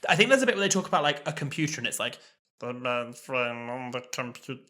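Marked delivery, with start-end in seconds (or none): 2.6-4.6 droning, muffled robot garble; garbled Cyberman. Retold or imitated. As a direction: drone unintelligibly like a Cyberman. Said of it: "the Cybermen ... are impossible to understand".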